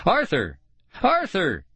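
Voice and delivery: shouting a name. A narrator's reading. Kids run after the sled, shouting. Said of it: "shouting his name".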